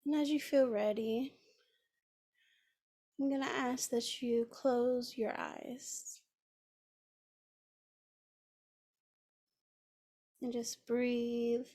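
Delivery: unhurried, soothing guide into the breath; guided meditation. Acted.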